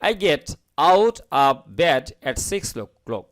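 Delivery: very slow, every word separated; choppy word by word. Shown as a demonstration. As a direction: chop "out of bed" into separate words. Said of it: "এভাবে কাটা কাটা করে বলবেন". (কাটা কাটা করে ধীরে বলা)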